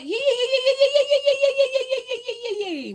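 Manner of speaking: very high, laughing cheer; giddy sing-song yays. Real, not acted. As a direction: squeal it like a happy chant. Cheering first chatter Mike.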